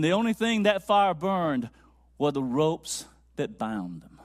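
loud, ringing pulpit declaration; triumphant sermon delivery; full-force preaching. Acted. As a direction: preach it out boldly to the congregation. Preaches to congregation.

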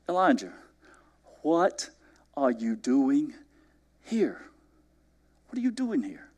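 God speaking gently to Elijah, quietly; a still small voice, barely above a breath. Acted. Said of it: "God came in a still small voice".

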